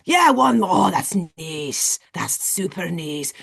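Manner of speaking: put-on Scottish accent. Scottish guy praising James's rep, Scottish accent. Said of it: "and the guy's like".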